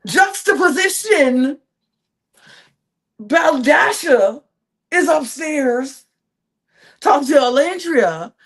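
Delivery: slow, stretched-out scene contrast; dramatic announcing. Acted. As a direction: spell out the irony, word by word.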